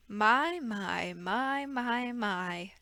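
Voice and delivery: a soft, drawn-out sly villain's "my, my"; Stefano's mock surprise. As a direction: purr it like a smug villain sneaking up. Stefano creeps up behind orphans.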